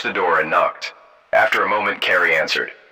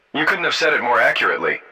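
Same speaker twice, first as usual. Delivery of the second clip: usual speaking voice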